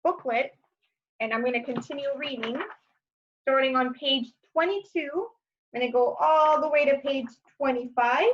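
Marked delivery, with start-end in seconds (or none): none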